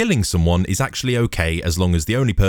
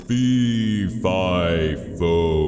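drawn-out ogre chant; booming giant; much slower than usual. Acted. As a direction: intone each word long, like a giant. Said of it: "those booming words filled the castle".

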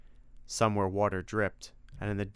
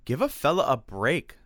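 higher, louder protest; indignant; 5 semitones higher, 5 dB louder than usual. A narrator's reading. Freddy protests Simon's doubt of him, indignant. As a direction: plead with wounded dignity. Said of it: "no small amount of indignity".

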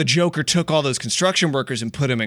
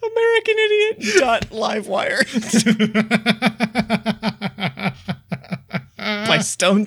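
laughing; 6 semitones higher than usual. Real, not acted. Cracking up over joke titles.

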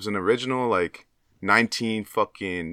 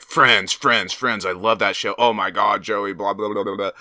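mock gushing fan; 2 semitones higher, 4 dB louder than usual. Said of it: "Everyone will be like".

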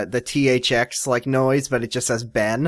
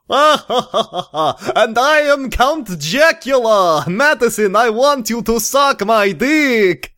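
loud, high, drawn-out Count in a mock Italian accent; slow, theatrical Italian-accented vampire, pitched high; hammed-up Italian accent; 9 semitones higher, 5 dB louder, much slower than usual. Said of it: "I pulled out my best Italian accent".

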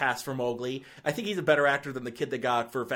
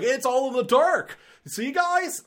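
much higher, mocking proud filmmaker voice; mock smug filmmaker; 11 semitones higher, 4 dB louder than usual. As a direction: squeak it out like a self-satisfied director.

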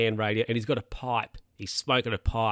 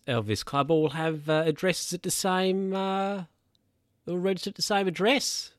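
higher, sly knowing voice; imitating Bernie; 7 semitones higher than usual. Bernie hinting to Jack about the car park scheme, higher sly voice. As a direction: put on a much higher, scheming old bloke.

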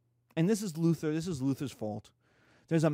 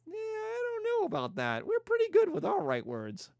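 much higher, teasing rabbi voice; mocking rabbi voice; 14 semitones higher than usual. Rabbis shrugging off Luther's claim, high teasing voice. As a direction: brush Luther off in a high sing-song.

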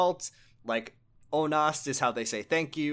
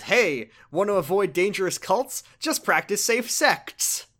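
pun delivery; 6 semitones higher, 5 dB louder than usual. Retold or imitated. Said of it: "And then he says".

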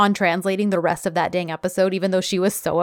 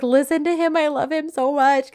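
higher, eager gushing; 8 semitones higher than usual. Retold or imitated. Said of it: "but I was like".

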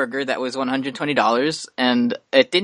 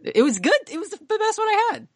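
excited high squeal. Real, not acted.

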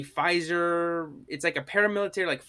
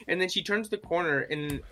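usual speaking voice

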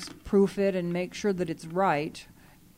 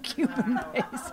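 amused, higher-pitched echo through laughter; laughing at an absurd mistake; laughing repeat; 6 semitones higher than usual. Real, not acted. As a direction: repeat it while cracking up. Laughing at the misread recipe.

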